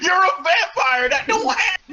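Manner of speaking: high shouted outburst. Real, not acted. Reader yelling at the fanfic's absurd line. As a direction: yell it in disbelief.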